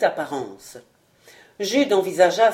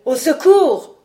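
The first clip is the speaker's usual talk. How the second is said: loud, raised shout for rescue; cry for help; 7 semitones higher, 7 dB louder than usual. A narrator's reading. Wounded squire calls for rescue, crying out. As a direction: shout it high to be heard far off. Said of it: "cria-t-il".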